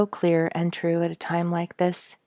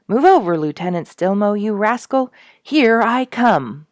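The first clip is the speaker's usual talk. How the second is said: playful loud call; 5 semitones higher, 8 dB louder than usual. Acted. Seasick Duke joking to lieutenant.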